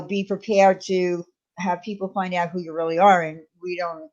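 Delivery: normal talking voice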